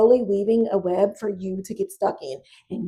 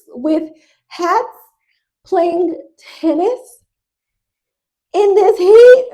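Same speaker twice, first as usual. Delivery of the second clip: slow, loud and higher, each word stretched in horror; outraged disbelief; 7 semitones higher, 7 dB louder, slower than usual. Real, not acted. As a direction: hammer out how absurd it is, louder toward the end. Outraged at the tennis outfits.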